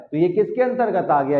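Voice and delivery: ordinary talk, nothing marked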